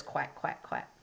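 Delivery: softly breathed quacks; whispered quacks. Shown as a demonstration. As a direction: whisper "Quack" like a joking duck. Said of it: "wood frog would be a duck".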